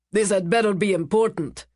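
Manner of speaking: put-on barked in a Slavic accent. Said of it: "he barked in his Slavic accent".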